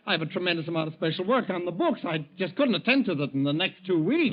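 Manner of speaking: flustered clerk begging his boss; panicked protest. Acted. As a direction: plead in alarm with the boss. Clerk panics at forced leave.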